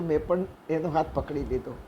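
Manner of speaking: ordinary delivery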